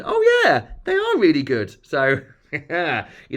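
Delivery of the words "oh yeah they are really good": very high, loud surprised oh; impressed nurse. Retold or imitated. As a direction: gush over the veins like a delighted nurse. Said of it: "and she went".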